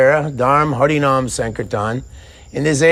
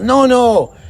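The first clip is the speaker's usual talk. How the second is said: insistent refusal; 9 semitones higher, 4 dB louder than usual. Acted. Lord insisting he give something.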